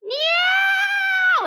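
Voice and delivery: very high, loud squealing meow; imitated cat meow. Meowing like the cat behind them on the plane. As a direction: mimic the panicked cat's yowl. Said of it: "all all we hear is".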